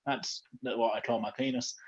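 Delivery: ordinary talk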